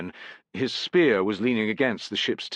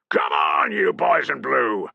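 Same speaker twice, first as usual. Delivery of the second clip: loud, slow rallying roar; rally the men forward; 2 semitones higher, 4 dB louder, slower than usual. A narrator's reading. Centurion roaring his legionaries into the charge. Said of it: "roared the centurion".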